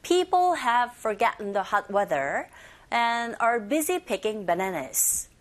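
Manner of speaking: slow whole-sentence model; very slow teacher model; slow model reading. Shown as a demonstration. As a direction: read it out slowly for the class. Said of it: "한 번 더".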